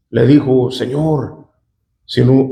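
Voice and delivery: usual speaking voice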